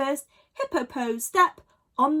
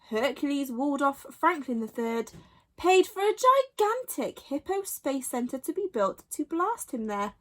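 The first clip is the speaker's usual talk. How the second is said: ordinary delivery, nothing marked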